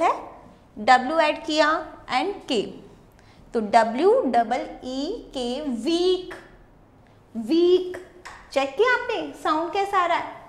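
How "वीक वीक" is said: वीक with the ई held long; long vowel. Shown as a demonstration. Teacher showing children the long sound, वीक drawn out. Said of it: "ई का long sound आ रहा है".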